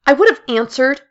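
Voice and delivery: high, loud and defensive; heated retort. Acted. Mara snapping back at Jill, loud and defensive. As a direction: throw it back hard.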